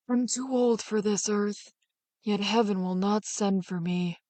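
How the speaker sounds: put-on old cattle drover wishing for heaven, slow and soft. A narrator's reading. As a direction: sigh it out like a weary old man.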